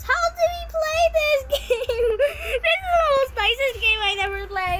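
high, laughing, near tears with delight; overexcited. Real, not acted. Kid telling viewers his favorite game, giddy and squealing. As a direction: squeal with joy over the game.

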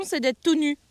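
ordinary delivery

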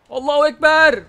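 loud, high hammed-up yell; loud joking battle cry; mock battle cry. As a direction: shout it as a joke war cry. Battle cry during game hit.

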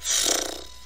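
short, airy sigh; breathy sigh of defeat; resigned sigh. Real, not acted. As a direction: exhale hard in resignation. New drive simply died.

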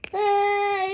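normal talking voice

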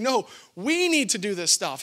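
WE punched, set against I; stress on WE. Retold or imitated. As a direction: hit the word we hard. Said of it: "But Paul's saying".

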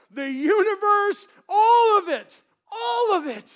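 loud, rising proclamation of God's glory; preaching at full force. Acted. Pastor thunders to the congregation about creation's purpose, loud and building.

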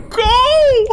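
high panicked yell; panicked shout. Real, not acted. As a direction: shout it in a fright. Fleeing monster on slide.

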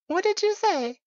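squeaky high, loud mock outrage; playfully offended what did you say; mock indignant. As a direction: pretend to be shocked at the cheek. Mock offense at partner's remark.

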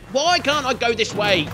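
ordinary delivery

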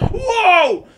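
very high, loud wow; silly high whoop. Pretend. YouTuber clowning for likes, silly high whoop. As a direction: let out a daft high squeal. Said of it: "deze mag gekke geluidjes maken".

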